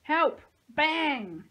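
loud, high cry and gunshot; yell, then burst out the shot; shouted. A narrator's reading. Nico keeps calling and firing, shouted.

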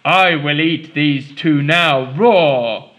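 loud booming giant; giant's roar. Acted. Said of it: "he roared".

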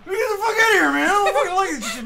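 angry yelling. Real, not acted. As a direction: blast it at him, fed up. Yelling at opponent in game.

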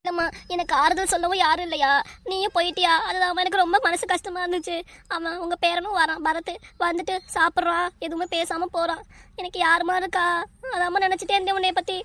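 high-pitched tearful lament; weeping. Acted. Daughter with nobody to console her, weeping to mother. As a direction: cry out your loneliness to her. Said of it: "ஏன்டா புஷ்பா இப்படி அழுவுற?".

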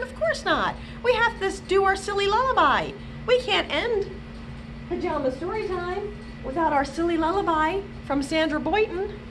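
usual speaking voice

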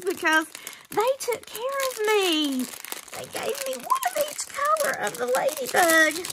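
very high, loud happy gushing; breathless excitement. Real, not acted. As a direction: still overjoyed, bubbling over. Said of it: "See, I'm out of breath".

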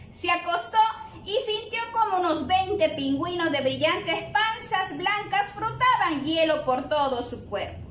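plain reading voice

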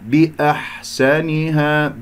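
بأحسنها slowly chanted, a thin airy ح held; soft light ha. Shown as a demonstration. Teacher modelling the ha, slow recitation with light ح in بأحسنها. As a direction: draw out بأحسنها with a delicate ح. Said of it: "الحاء لطيفة رقيقة".

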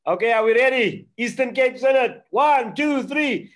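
loud, high, bright call to the group; rally everyone for the group picture; photo countdown. Real, not acted. Pastor calling the Zoom group to smile for the photo, loud and high.

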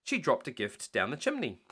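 ordinary delivery, nothing marked

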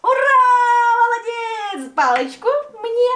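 high bright cheering; excited praise. Real, not acted.

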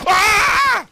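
high, loud startled shriek; real startled scream; sudden scream. Real, not acted. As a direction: scream as the frog kills you. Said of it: "I screamed".

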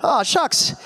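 aw-shucks modesty. Retold or imitated. Osric humbly after the fight.

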